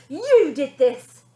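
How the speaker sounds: loud, slow accusation; angry demand. A narrator's reading. Girl accusing her family at the stairs, loud and slow. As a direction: accuse them from below.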